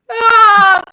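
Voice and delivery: high, loud cry of relief; overjoyed cry. Retold or imitated. Greeting a Canadian driver at a rest stop, loud cry. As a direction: let out a big emotional wail. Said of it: "I was like".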